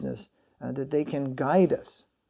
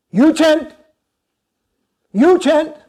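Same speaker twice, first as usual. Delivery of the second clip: retold guru's sharp, much higher, louder shouted command; short shout; 12 semitones higher, 13 dB louder, slower than usual. Guru shouting at devotees to put the recorders away, sharp loud command. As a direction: bark it out short, like an order to start now. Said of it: "He shouted out just very short like that".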